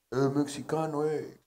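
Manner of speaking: lightly put-on gringo Spanish; a gringo bartender taunting in Spanish; American accent. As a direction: stretch "mexicano" like an American. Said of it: "Es como habla".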